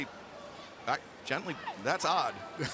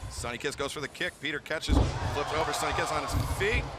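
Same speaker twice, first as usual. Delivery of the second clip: wrestling commentator; 3 semitones lower than usual. Real, not acted. Live wrestling play-by-play.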